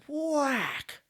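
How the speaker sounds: high, comic whack noise; mock punch sound. Telling a pub joke, the punch sound as a high comic whack. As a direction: spit out a cartoon smack.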